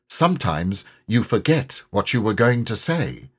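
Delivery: ordinary delivery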